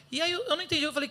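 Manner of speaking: normal talking voice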